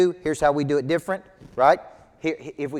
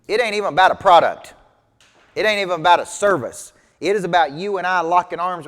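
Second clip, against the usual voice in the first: louder, higher, fervent declarations; emphatic conviction; 4 semitones higher, 7 dB louder than usual. Real, not acted. Coach passionately telling agents it's about people, louder. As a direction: proclaim it with fire. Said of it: "stressing his conviction".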